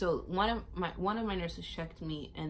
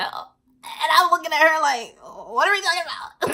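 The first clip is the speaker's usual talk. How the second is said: mock panic; 11 semitones higher, 12 dB louder than usual. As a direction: shriek it in comic alarm.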